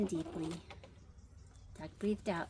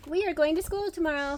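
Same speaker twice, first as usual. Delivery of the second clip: higher, louder and quick; excited announcement; 8 semitones higher, 8 dB louder, faster than usual. Acted. Farmer telling the chickens about school, excited. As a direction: blurt out the good news.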